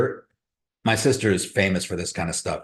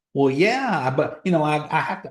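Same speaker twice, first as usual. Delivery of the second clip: higher-pitched Georgia twang, making excuses; put-on rural Georgia accent, pitched up; country bumpkin accent; 5 semitones higher than usual. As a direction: imitate a sister hedging in her country accent. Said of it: "She's got a country bumpkin accent".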